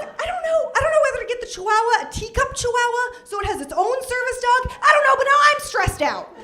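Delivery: high, frazzled, rising panic; anxious and overwhelmed, high-pitched; high stressed voice. Retold or imitated. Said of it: "And I'm like".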